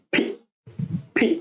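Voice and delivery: ফি with both lips pressed, then a breathy release; lip-folded fee. Shown as a demonstration. Teacher drilling ফি with folded lips, clearly. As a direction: puff ফি out through folded lips. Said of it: "ঠোঁট ভাঁজ করে ফি".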